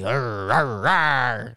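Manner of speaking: rolling "arr" growls; mock-crazy growl. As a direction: growl it like you've gone crazy. Crazy noises after "I'm crazy.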